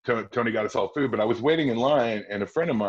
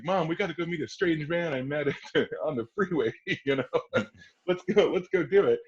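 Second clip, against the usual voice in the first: laughing plea; 7 semitones higher than usual. Retold or imitated. Teller begs his mom, laughing.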